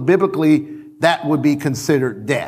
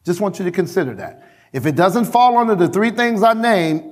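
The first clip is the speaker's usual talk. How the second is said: normal talking voice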